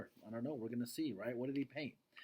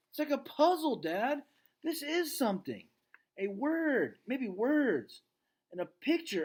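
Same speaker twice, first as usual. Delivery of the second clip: put-on very high, eager young voice; rising excitement of discovery; excited girl; 11 semitones higher, 5 dB louder than usual. Julia finds letters in the paintings, excited girl voice.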